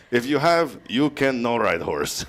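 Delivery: slow broken English with a hint of Mexican accent; mock Mexican accent. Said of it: "the real heavy accent".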